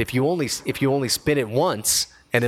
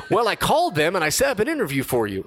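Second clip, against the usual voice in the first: high, proud mom voice; imitating mom, higher and pleased; 8 semitones higher than usual. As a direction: tell him the news like a proud mom. Mom reveals she called.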